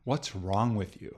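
soft, slow mock-concerned question; imitated prying question. People asking a single woman in her thirties, imitated prying. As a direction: draw the question out quietly, imitating nosy people. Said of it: "people are asking you".

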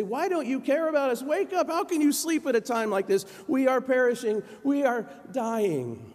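high, loud, hammed-up panic; terrified, dramatic plea. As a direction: cry out in mock terror to wake a sleeper. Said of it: "but they were terrified".

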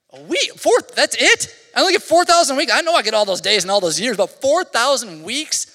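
loud, high, incredulous; stunned disbelief. Real, not acted. Pastor to congregation, shocked by a short life, rising loud disbelief. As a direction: cry out in shocked disbelief at how few. Shocked at only four thousand weeks.

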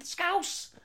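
high, slow put-on Scouse accent. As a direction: drag it out in a silly high Scouse. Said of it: "What the fuck is that accent".

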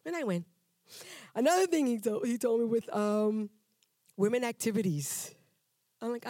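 ordinary talk

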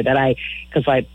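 usual speaking voice

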